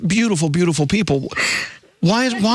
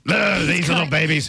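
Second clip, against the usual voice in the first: mom's fond scoff. Retold or imitated. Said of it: "your mom's over there like".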